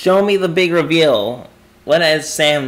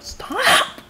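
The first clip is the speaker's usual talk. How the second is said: very high, breaking shriek; shrieked protest; 4 dB quieter than usual. Real, not acted. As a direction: shriek at it to stop. Another note appears.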